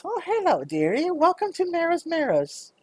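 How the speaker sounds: put-on high, sugary old-lady voice; a petite shopkeeper welcoming a customer in a high sweet voice; sweet little shopkeeper. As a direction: greet them like a doting granny. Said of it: "a pretty little petite woman that goes".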